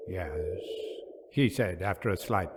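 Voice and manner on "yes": low, muffled and hollow; strange hollow voice. Acted. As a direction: say it hollow and dazed. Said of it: "His voice also seemed strange".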